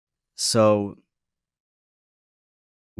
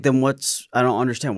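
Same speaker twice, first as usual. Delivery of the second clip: higher, louder baffled demand; retold freak-out; 6 semitones higher, 6 dB louder than usual. Date demanding the deal, retold as a mild freak-out. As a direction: mildly imitate someone freaking out. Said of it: "she essentially, like, freaks out a bit".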